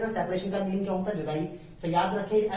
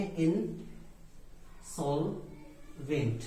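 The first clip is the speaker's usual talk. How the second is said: Insolvent in three slow, separated parts, softer; slow syllable demonstration; 3 semitones lower, 6 dB quieter, much slower than usual. Shown as a demonstration. Teacher spells out the term by ear, slow syllables. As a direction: say in... sol... vent with long gaps for insolvent. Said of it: "क्या कहते हैं".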